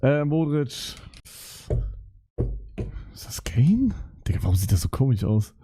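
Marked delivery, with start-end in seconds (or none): 3.2-3.9 whisper. Real, not acted. Wonders aloud at a player photo.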